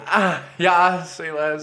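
high put-on bro catchphrase; douchey laugh. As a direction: open high with a fake laugh, then toss off the line. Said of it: "the douchey laugh was either before or after".

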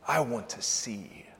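slow, hushed whisper; whispered plea. Retold or imitated. Blind man answers Jesus, whispering his plea. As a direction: whisper the wish slowly.

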